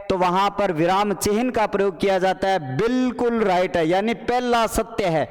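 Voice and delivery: ordinary talk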